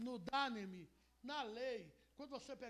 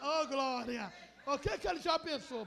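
loud sudden "glória" burst; excited exclaim; 8 dB louder than usual. Real, not acted. Preacher calling out to the congregation, loud and excited. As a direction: shout it like a preacher fired up.

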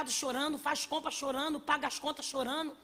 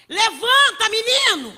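shouted, higher command to rise; loud command; 6 semitones higher, 14 dB louder than usual. Acted. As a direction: order the boy up loudly. Jesus commands the boy.

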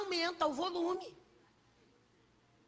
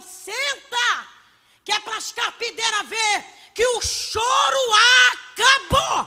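shouted, higher command building to the end; loud command; 7 semitones higher, 12 dB louder, slower than usual. Acted. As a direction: command it so the mourners hear. Jesus commands, ends weeping.

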